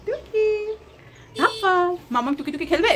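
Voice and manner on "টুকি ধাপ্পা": high playful peekaboo call. Acted. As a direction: pop out high like a surprise game. Peekaboo game with toddler. (উঁচু গলায় খেলার ডাক টুকি ধাপ্পা)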